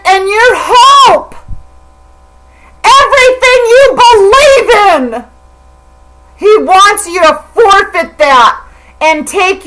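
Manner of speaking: tearful preaching. Real, not acted. Preaching to her viewers, crying out. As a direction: plead through tears, voice cracking upward.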